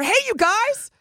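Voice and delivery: high, bright waitress greeting; bubbly server voice. Retold or imitated. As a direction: greet the table like a peppy server. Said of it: "That server will come over".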